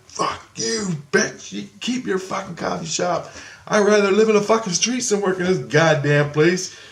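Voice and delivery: high-pitched mock yell at the boss; imitated yelling. Dreamer tells boss to keep her coffee shop, mock yelling. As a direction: re-enact yelling in a strained high voice. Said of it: "I'm yelling, I'm like".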